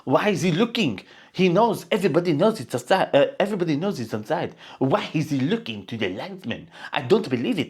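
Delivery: lightly voiced imitation of Thierry Henry; a hint of a French pundit; mocking Henry. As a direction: mimic the pundit's whine. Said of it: "took the piss out of him and said".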